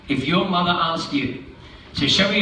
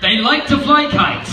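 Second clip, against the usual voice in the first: excited cat voice; 8 semitones higher than usual. Acted. Cat in the hat hyping kites to the kids. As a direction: announce the fun with a flourish. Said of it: "said the cat".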